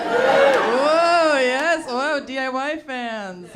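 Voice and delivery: loud, high, hammed-up cheering; mock cheer. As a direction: whoop like a pumped-up crowd. Mock crowd cheer for IKEA.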